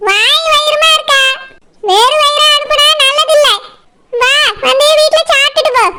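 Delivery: high-pitched, motherly insistence at normal volume; insistent mother. Acted. Mother urging a pregnant guest to come eat, insistent. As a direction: brush off the refusal in a high voice.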